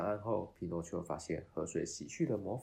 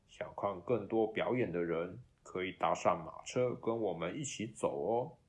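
circus ringmaster voice; 5 dB louder than usual. Acted. Circus master invites.

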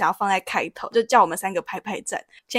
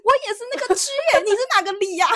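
put-on much higher and quick, an excited old uncle; excited old man; 10 semitones higher, faster than usual. Old man learns they share a district, excited and high. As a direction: squeal it like a thrilled uncle finding a neighbour. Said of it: "那个阿伯更兴奋".

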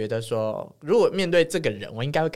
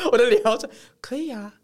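laughing; 9 semitones higher, 5 dB louder than usual. Real, not acted. Laughing at own face.

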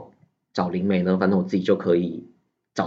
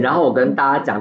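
ordinary talk, nothing marked

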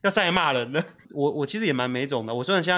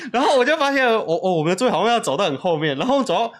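ordinary talk, nothing marked

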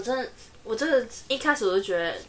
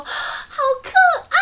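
much higher squeal on 可爱; squealing cute; 3 dB louder, much faster than usual. Retold or imitated. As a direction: squeal over something cute. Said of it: "我就说".

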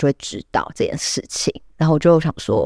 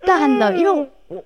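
higher, slow panicked gasp; patient spotting the smoking question, half-joking panic; mock panic; 8 semitones higher than usual. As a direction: let the panic spill out. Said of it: "我当时有点太慌了".

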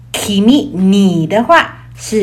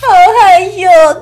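高而快的害羞哭腔; 不好意思地撒娇; bashful whine; 7 semitones higher, 6 dB louder, much faster than usual. Acted. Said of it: "恥ずかしくて". (害羞地哭腔说)

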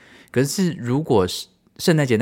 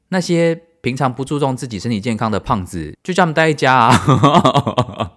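imitating roommate; 6 semitones higher, 6 dB louder than usual. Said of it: "然后他就讲说".

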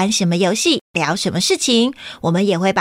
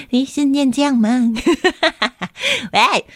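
plain reading voice

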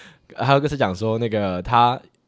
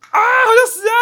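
high, loud wailing scream; drunken screaming; 7 dB louder, faster than usual. Retold or imitated. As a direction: scream it out as if you're dying. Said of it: "我就一直在大叫". (崩溃大叫)

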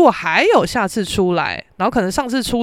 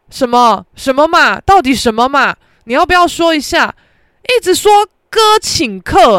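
louder, exasperated ranting; real exasperation, louder and higher; real annoyance; 6 semitones higher, 7 dB louder than usual. Real, not acted. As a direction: vent the irritation, pressing each question. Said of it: "我真是超不爽的".

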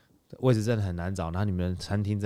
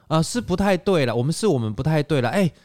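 high, louder mock apology; imitating the driver; 9 semitones higher, 5 dB louder than usual. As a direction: play the driver, flustered and apologising. Driver's sheepish apology retold. (高声模仿司机慌张道歉)

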